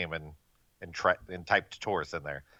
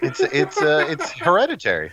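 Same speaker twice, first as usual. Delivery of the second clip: loud retort; 9 semitones higher, 10 dB louder than usual. Real, not acted. Shouted movie title retort.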